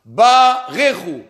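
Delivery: "Barechu" said slowly, syllable by syllable; slow, measured teaching pronunciation of "Barechu"; drawn-out syllables. Shown as a demonstration. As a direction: stretch BA, then release "rechu". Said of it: "Le mot Barechu".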